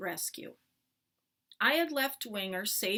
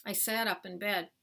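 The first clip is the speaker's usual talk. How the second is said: ordinary delivery, nothing marked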